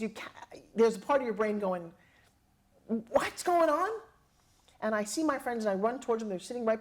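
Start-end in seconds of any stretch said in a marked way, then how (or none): 2.9-3.9 slow, high puzzled question; dazed confusion. Retold or imitated. Her stunned mind trying to make sense. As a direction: stretch it out in disbelief.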